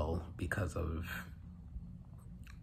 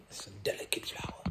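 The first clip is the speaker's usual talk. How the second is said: whispered aside about her; hushed, quick aside; whispering; 6 dB quieter, faster than usual. Real, not acted. Husband whispering about fragile wife.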